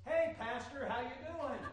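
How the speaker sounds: high-pitched acted hail; mock greeting. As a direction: hail the pastor in a put-on high voice. Churchgoer greets pastor in the story.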